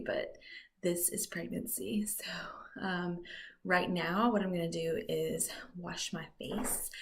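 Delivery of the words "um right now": ordinary talk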